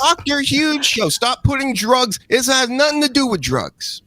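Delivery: high-pitched insistent correction; exasperated scolding. Acted. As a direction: scold a machine that won't listen. Scolding the search engine.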